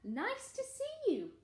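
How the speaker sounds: high, drawn-out singsong hoot; happy duckbill call; hooted greeting. A narrator's reading. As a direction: hoot a friendly welcome, stretching it up high. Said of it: "and they hooted".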